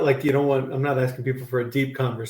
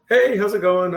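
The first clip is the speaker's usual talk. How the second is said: cheery greeting; 8 semitones higher, 4 dB louder than usual. Retold or imitated. Cheerful hiking greeting imitated.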